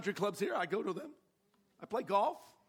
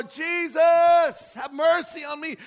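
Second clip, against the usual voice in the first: shouted high and loud, desperate; shouting for mercy; 8 semitones higher, 10 dB louder, slower than usual. Retold or imitated. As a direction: scream the plea with all you have. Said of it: "he cries out all the more".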